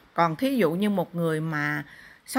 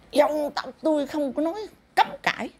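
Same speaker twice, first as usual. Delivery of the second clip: high mock spirit retort; imitating the spirit; 7 semitones higher than usual. Medium retells the spirit's retort in a high mock voice. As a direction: echo the spirit's sharp retort, pitched high.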